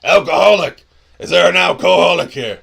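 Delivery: high, very loud hollering; bartender's shout. Acted. Bartender calls out to customers for the prank name, shouting. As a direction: yell it out across a noisy bar. Bartender calling out across the bar.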